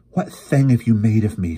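anguished, accusing outcry; horrified gasp. A narrator's reading. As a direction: gasp it out, appalled at oneself. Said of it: "she gasped".

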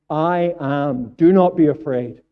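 loud, ringing command; Jesus calming the disciples in the storm, booming; commanding proclamation. Acted. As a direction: proclaim it with total authority. Voicing Jesus's commanding words.

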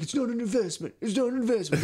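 high, nagging mock bill collector; mock creditor. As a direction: squeak it like an annoyed debt collector. Creditors voiced mockingly, high.

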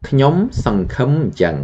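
ខ្ញុំសង្ឃឹមអញ្ចឹង run together quickly at high pitch; fast whole sentence. Shown as a demonstration. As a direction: rattle off ខ្ញុំសង្ឃឹមអញ្ចឹង in one breath. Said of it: "So the whole sentence".

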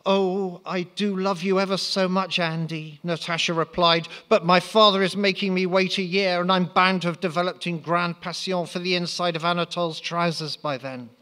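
put-on girlish Natasha. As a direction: lift the voice for a coy young lady. Natasha voiced by reader.

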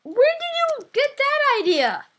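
very high, slow mock outrage; high indignant. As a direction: squeak out the denial.

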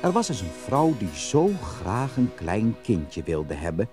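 ordinary delivery, nothing marked

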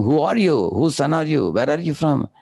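retold astonished questions, pitched high; imitating a surprised king; surprised questioning. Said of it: "immediately king became surprised".

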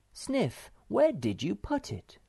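high-pitched mother voice; motherly questioning. Acted. Mother asks Sniff for the cake, high motherly voice.

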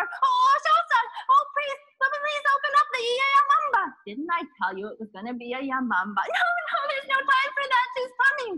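very high, loud frantic plea; panicked little boy. Acted. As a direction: scream for the priest to open up. Boy pounds temple door.

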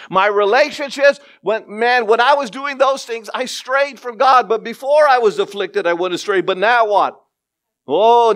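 high, loud impassioned sermon; full-force preaching. Acted. Preacher voicing David's story to the congregation, impassioned. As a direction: preach it out with fire.

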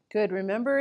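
ordinary talk

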